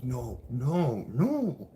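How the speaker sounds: rising, high desperate no; retold freak-out on the phone line; panicked denial. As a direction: spiral into panic. Freaking out in court.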